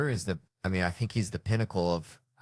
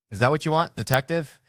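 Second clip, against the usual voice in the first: raised, high-pitched challenge; mock yelling back; 7 semitones higher, 4 dB louder than usual. Suspect yelling back at the detective, mock angry. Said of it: "He's yelling back".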